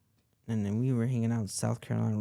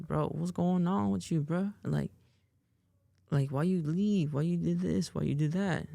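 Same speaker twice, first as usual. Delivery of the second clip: higher-pitched, questioning; lightly voiced concerned uncle; 6 semitones higher than usual. Uncle calling his nephew to ask what happened, concerned. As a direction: lightly imitate an uncle pressing for answers.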